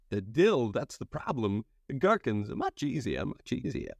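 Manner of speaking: high, straining halting Walken voice; Christopher Walken opening a jar. Pretend. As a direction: strain against a stuck pickle jar. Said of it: "Christopher Walken, uh, struggling to open a jar of pickles".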